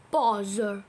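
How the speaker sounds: low, emphatic grumble; understated low exasperation; mildly vexed Pooh. Acted. Pooh stuck inside the jar.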